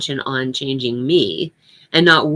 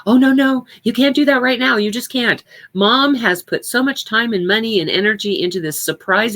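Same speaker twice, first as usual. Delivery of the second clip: urgent pleading; 8 semitones higher than usual. Retold or imitated. Child begging a parent not to ruin mom's surprise, high urgent pleading.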